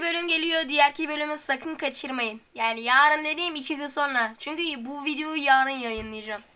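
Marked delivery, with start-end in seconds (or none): none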